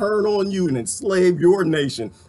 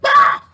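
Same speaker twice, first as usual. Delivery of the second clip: frustrated scream; 8 dB louder than usual. Acted. Frustrated outburst at Facebook.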